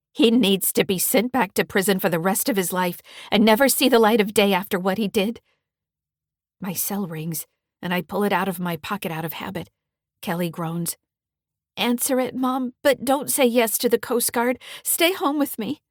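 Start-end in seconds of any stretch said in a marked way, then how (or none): 0.1-5.4 hoarse and louder, demanding justice. Acted. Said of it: "say in a hoarse voice". 11.8-15.8 put-on much higher, pleading girl voice; teen daughter. Kelly voiced by narrator.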